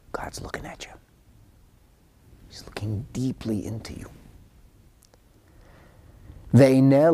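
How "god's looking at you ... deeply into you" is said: hushed whisper full of awe; awed whisper. Real, not acted. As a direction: whisper it like a sacred secret. God watching you, hushed awe.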